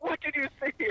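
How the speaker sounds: high, pressing question; imitating a worried wife; anxious wife. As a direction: demand to know what he saw. Wife demanding answer.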